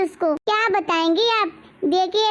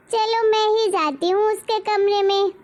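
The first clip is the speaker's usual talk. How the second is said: usual speaking voice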